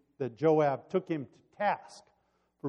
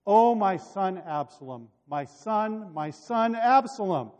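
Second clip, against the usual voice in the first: higher, louder lament; narrated cry of mourning; crying out in grief; 6 semitones higher, 7 dB louder than usual. A narrator's reading. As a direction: wail the name as a bereaved father, without sobbing. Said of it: "burst into tears ... he cried".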